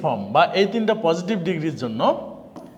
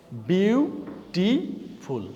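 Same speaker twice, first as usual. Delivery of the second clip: drawn-out syllables; 3 dB quieter, much slower than usual. Shown as a demonstration. Teacher counting syllables aloud.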